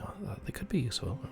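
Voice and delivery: overheard whisper. Acted. Sisters huddled in the corner, whispering about the party. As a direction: murmur it like a secret huddle. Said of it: "whisper each other".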